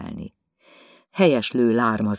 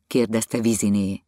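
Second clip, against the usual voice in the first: ordinary delivery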